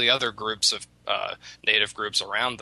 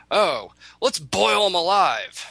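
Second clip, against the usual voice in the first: much higher, slow, gleeful cruelty; gleeful animal; 9 semitones higher, slower than usual. Retold or imitated. One animal proposes boiling the rabbit, gleeful and high. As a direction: savour the idea of boiling him. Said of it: "One of them says".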